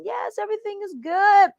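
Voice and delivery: relaxed, chilling. Retold or imitated. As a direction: sing it out like nothing could go wrong. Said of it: "you're chilling, you're relaxing".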